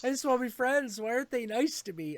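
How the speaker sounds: whiny cat. Retold or imitated. Cat pleading to its owner, whiny. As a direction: plead like a sad kitten. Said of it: "Gomez was always like".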